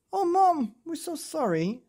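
put-on very high child's apology, loud at first; pleading apology. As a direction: whine sorry to mum. Child apologises to soaked mother.

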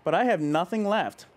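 put-on reading to children. A narrator's reading. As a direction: let the sad ending settle gently. Reads picture book to kids.

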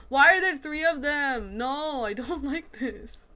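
loud, high wail of protest; real alarm with a laugh breaking in; panicked complaint. Real, not acted. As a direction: yell in flustered dismay. Too many enemies at once.

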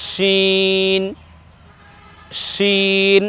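শিন with plenty of air in the sh; long level শিন opened by a thick sh; airy sheen. Shown as a demonstration. Teacher demonstrates the extra air, long airy শিন. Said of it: "একটু বাতাস বেশি বের করে".

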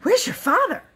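high-pitched, breathless question; panting. A narrator's reading. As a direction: demand it urgently, out of breath. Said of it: "he panted".

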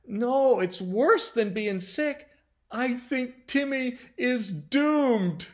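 high, loud, dramatic despair; crying out. A narrator's reading. Susan telling mom about Timmy, crying out in despair. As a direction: cry it out, dragging slowly into doom. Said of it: "Well, Susan cried".

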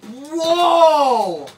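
real, high, loud cry of amazement; shocked outburst. Real, not acted. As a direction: yell it out, stunned, drawing out the word. Stunned by the battle result.

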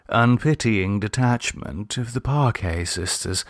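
ordinary delivery, nothing marked

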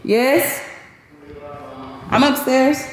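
calling out from upstairs; yelling across the room. Real, not acted. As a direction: raise the voice to carry. Answering someone downstairs.

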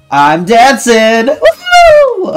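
loud, high, stretched-out cheer; slow high shout rising into a whoop; jubilant whoop. Real, not acted. Player celebrating a lucky find, loud jubilant whooping.

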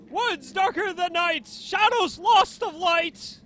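high, loud game-character voice; high-pitched theatrical cartoon reading; Krillin. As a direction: read it high and dramatic, pushed hard. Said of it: "my voice sounds like, uh, Krillin".

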